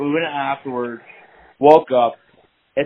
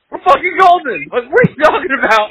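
much higher, louder hyped boast; cocky excitement; 11 semitones higher, 7 dB louder than usual. Retold or imitated. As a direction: brag like you just won. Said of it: "And I'm like".

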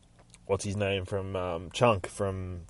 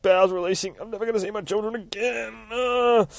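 mock death lament; 12 semitones higher than usual. Monster dying theatrically.

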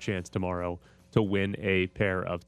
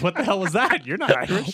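higher, louder mock disbelief; mock incredulous reaction; 7 semitones higher, 5 dB louder than usual. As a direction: act out a baffled bystander, voice raised. Voices wife's reaction to fake accent.

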